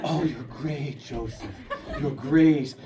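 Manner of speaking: a hint of love-bombing boyfriend; lightly voiced doting partner; Colin's gushing. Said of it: "he's going".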